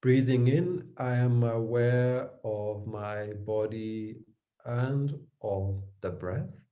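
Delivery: slow, measured meditation voice; unhurried guidance with long pauses between phrases; guided meditation. Real, not acted. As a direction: lead a breathing meditation, drawing each phrase out. Leads guided meditation after bell.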